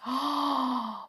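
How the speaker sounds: long, theatrical intake of breath; big drawn-in gasp; excited gasp. A narrator's reading. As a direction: gasp with delight before exclaiming. Father gasps before exclaiming.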